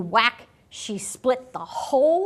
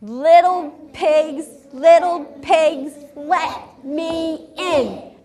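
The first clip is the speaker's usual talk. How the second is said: high, slow wolf calling at the door; 5 semitones higher, 5 dB louder, slower than usual. Acted. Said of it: "And he says".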